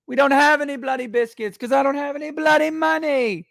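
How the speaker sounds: very high hammed-up British mother; terrible British accent. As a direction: overdo a British mum's high-pitched scolding. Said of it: "Yeah, it's terrible".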